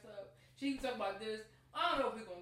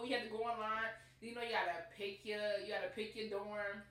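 normal talking voice

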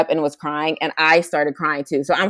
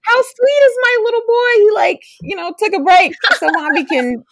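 put-on much higher, cooing over her baby; doting coo; 13 semitones higher than usual. Mother doting on her sweet little boy, high coo. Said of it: "I'm like".

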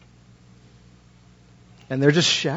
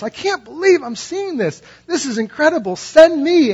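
much higher, breathless with wonder; overwhelmed awe; 10 semitones higher, 4 dB louder than usual. Retold or imitated. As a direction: gasp it out as if seeing God's glory. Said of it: "Isaiah is overwhelmed by this".

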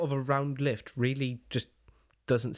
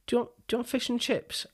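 high, softer mum voice; imitating a mum; 10 semitones higher, 3 dB quieter than usual. Friend's English mum asking the Welsh boy, high mock voice. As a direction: do you want fish and chips, mum pitched up high.

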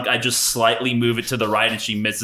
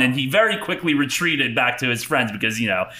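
normal talking voice

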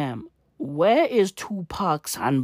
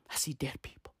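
whisper; 4 semitones lower, 17 dB quieter, faster than usual. Pretend. Quoting a spooky film line.

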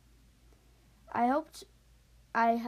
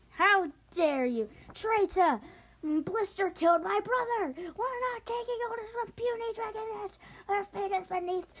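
furious soldiers; 8 semitones higher than usual. Acted. Skywing soldiers shouting at the dragonets, enraged. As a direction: roar at the traitors as if betrayed. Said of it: "so they're pretty mad".

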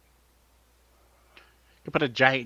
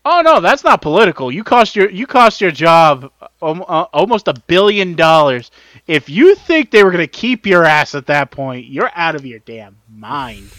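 loud rant; 5 semitones higher, 11 dB louder than usual. Real, not acted. Heated rant about Tucker Carlson.

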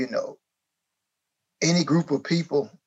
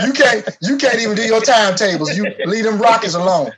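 loud, higher, laughing through it; laughing scold; 6 semitones higher, 7 dB louder than usual. Pretend. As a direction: tease while laughing hard.

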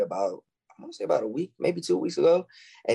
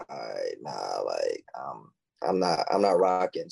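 unimpressed refusal; 12 semitones lower, slower than usual. Acted. Girl turning down an awkward approach, unimpressed.

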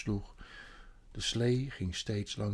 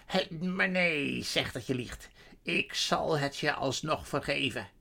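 coaxing sorceress. A narrator's reading. Witch offers forgiveness for a lie, coaxing. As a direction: drop from fury into controlled coaxing. Witch coaxes animal to recant.

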